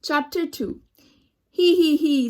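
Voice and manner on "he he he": loud, higher chuckle he he he. Acted. Man laughing at his pig behind the wheel, loud chuckle. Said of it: "says Mister Watson".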